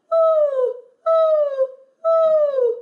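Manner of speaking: loud, very high owl-like "ooh"; high owl hoot. Shown as a demonstration. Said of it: "High voice sounds like an owl".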